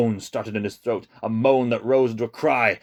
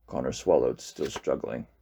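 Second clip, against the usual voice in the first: usual speaking voice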